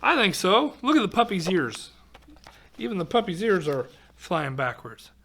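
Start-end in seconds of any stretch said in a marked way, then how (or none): none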